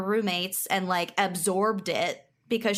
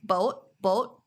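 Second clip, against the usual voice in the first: "boat" twice with a Minnesotan long O; Minnesotan accent. Shown as a demonstration. Host demonstrating her word for co-hosts, Minnesotan accent. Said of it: "can't say without a Minnesotan accent".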